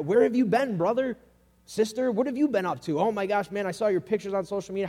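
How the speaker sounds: accusing interrogation. Acted. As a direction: grill the returning brother or sister. Acted accusing greeting of returning believer.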